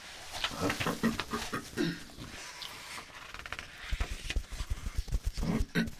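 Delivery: dry cough; a short clearing cough. Real, not acted. Cough mid-lecture.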